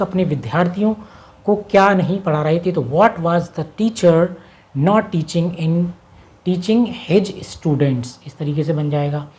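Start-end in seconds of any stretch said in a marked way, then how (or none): 2.8-8.1 slow dictation. Shown as a demonstration. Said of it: "teacher reads translation".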